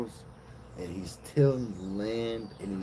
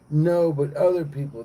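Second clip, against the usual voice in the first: high, loud acted objection; alarmed protest; 6 semitones higher, 7 dB louder than usual. Cain pleading with God about other people, alarmed. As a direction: burst in with a raised, worried protest.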